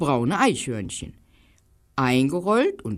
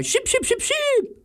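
much higher, squawking blackbird cry; squawky high bird chatter; blackbird scolding. Acted. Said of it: "Und dann wieder".

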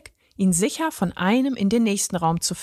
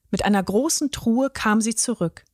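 plain reading voice

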